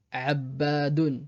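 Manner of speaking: Abbadun lentement, b doublé; Abbadun, appuyer sur le bb; doubled b. Shown as a demonstration. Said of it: "la même chose avec d'autres mots". (Abbadun avec shadda, b double)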